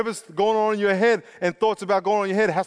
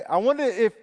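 ordinary talk

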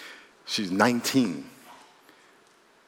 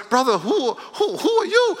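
incredulous; 13 semitones higher than usual. Retold or imitated. Preacher retelling his shocked reply to the accuser, incredulous. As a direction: ask it stunned and baffled. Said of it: "And I was like".

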